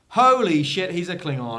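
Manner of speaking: loud, high burst of disbelief; shocked exclamation. Retold or imitated. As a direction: blurt it out like a stunned viewer. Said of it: "everyone's like".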